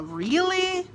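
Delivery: very high Really of disbelief; indignant disbelief. Shown as a demonstration. Hearing someone disrespected the pastor, appalled disbelief. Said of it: "Aí você fala".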